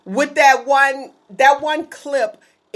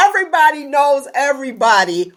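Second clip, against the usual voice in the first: ordinary delivery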